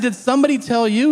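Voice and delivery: high, loud, fast demand; exasperated questioning. Retold or imitated. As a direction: press your dad for an answer, exasperated. Acted questioning of dad continues.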